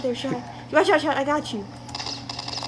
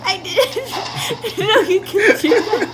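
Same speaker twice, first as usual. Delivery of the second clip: giggling helplessly through the words; high, broken up by laughter; laughing; 8 semitones higher, slower than usual. Real, not acted. Player to teammate after a slip, laughing.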